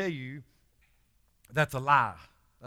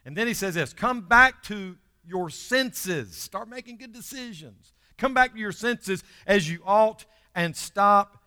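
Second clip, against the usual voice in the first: full-force preaching; 3 semitones higher, 8 dB louder than usual. Real, not acted. Pastor exhorting the congregation, loud and impassioned. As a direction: preach it hard to the room.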